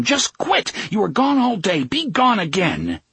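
high, frantic outburst, easing slightly; screaming. A narrator's reading. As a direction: scream at the voices to leave. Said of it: "he screamed at them".

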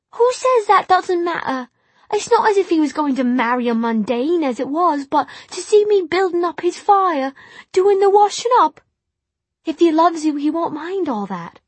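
high, loud tearful outburst; upset girl pouring it out. Acted. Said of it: "then the tears did fall".

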